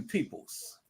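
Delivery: voiceless whisper to the viewers; whispered aside. Real, not acted. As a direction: lean in and breathe the word out. Hushed aside to the audience.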